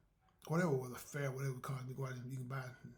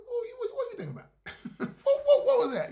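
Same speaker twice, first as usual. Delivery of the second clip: high loud laughing question; laughing disbelief; 11 dB louder than usual. Retold or imitated. Family laughing together at old memories, high laughing. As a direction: crack up mid-question.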